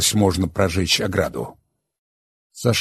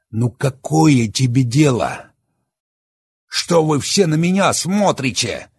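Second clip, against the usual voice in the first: raised, much higher and louder angry voice; Oleg, bitter and enraged; 10 semitones higher, 7 dB louder than usual. Acted. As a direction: hurl the confession at them defiantly. Oleg's bitter outburst.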